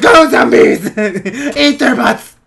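high, loud rallying shout; mock battle command. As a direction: yell orders to your zombie horde, half laughing. Acts himself commanding the zombie horde.

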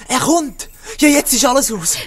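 loud, high, panicking; panicked cry. Acted. Prisoner panics as the guard approaches, loud and high. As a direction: cry out that all is lost.

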